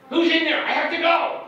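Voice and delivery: high and loud, urgent; demanding secretary. Retold or imitated. Secretary shouting at the locked bathroom door, high and loud. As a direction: yell through the door, she needs in now.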